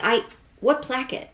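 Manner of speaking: ordinary talk, nothing marked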